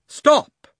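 high, loud, sudden command; witch's cry. A narrator's reading. Witch halting the man beside the children, commanding cry. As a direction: cry out sharply to halt everyone. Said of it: "cried the witch".

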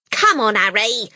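loud, brisk, common-sounding wife; harried mother voice. A narrator's reading. Wife tells husband about the packing, harried and loud. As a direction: complain to the husband while packing.

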